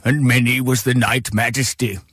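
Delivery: low, solemn wartime-orator impression; ponderous old statesman reminiscing; Winston Churchill. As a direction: address the monarch in a mock-grand statesman's voice. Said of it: "That's, uh, Churchill".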